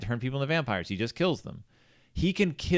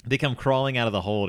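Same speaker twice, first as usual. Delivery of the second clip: ordinary talk, nothing marked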